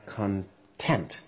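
"content" as con-TENT, slowly tried out; stress on second syllable. Shown as a demonstration. Actor tries the suggested content, slowly stressed con-TENT. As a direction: feel out "content", landing on TENT. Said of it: "I'll try it".

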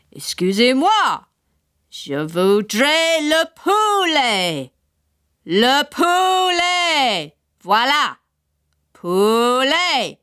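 slow high over-clear French. A narrator's reading. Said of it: "repeated ... a bit louder".

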